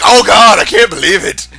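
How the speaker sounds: hammed-up panicked hero; mock Mario. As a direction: ham up Mario's shock. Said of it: "He's like".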